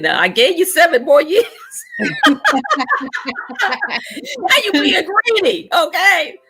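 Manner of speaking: high laughing mock scold; playful scolding. Daughter voicing God teasing her for being greedy, high and laughing. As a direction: tease yourself through a laugh as God.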